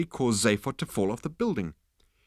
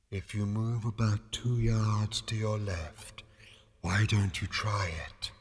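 slow, breath-soft ethereal advice; deep, quiet voice; 4 dB quieter, slower than usual. A narrator's reading. Disembodied voice guiding Zaphod to footholds, quiet. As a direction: suggest it calmly, drawing the words out. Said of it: "The voice was deep and quiet".

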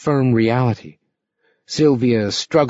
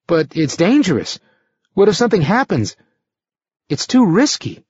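higher, faster nervous pleading; anxious pleading; 7 semitones higher than usual. A narrator's reading. Youth pleads about danger.

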